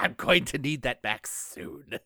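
slow, put-on cartoon villain voice; theatrical villain impression; Cobra Commander. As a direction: demand it as a hammy supervillain, drawn out. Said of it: "from Cobra Commander".

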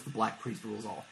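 low, quiet ominous line; ominous quote. Retold or imitated. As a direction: deliver it low like a prophecy. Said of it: "she goes".

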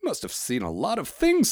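high-pitched wide-eyed questioner; curious postman. A narrator's reading. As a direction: ask in a high, impressed voice. Young postman voiced in story.

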